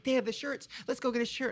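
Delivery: a hint of excited mom; eager mom. Retelling mom at the store.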